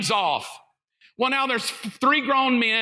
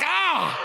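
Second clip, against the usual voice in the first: startled yelp; 5 semitones higher than usual. Retold or imitated.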